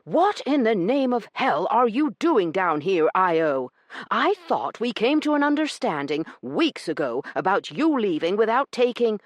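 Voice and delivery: high, loud furious man's voice; angry Corbin. Acted. Corbin confronting Io in the cab, furious. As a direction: tear into her.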